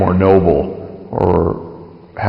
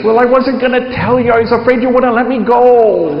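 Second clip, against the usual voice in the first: put-on higher, sheepish little-kid confession; 13 semitones higher than usual. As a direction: own up like a boy caught out. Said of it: "Like a little kid".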